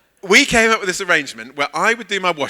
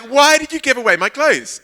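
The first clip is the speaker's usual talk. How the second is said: raised, higher voice with a hurt edge; upset complaint; 9 semitones higher, 5 dB louder than usual. Retold or imitated. Asking the church lady why she gave clothes away, upset and raised. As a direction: demand it, wounded and indignant. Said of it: "I was really upset at this point".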